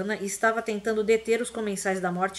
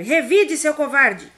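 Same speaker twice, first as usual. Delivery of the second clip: high, loud angry demand; furious shout; 6 semitones higher, 9 dB louder, slower than usual. A narrator's reading. Harry screams at Snape to fight back, furious. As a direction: scream it in his face. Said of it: "gritou Harry".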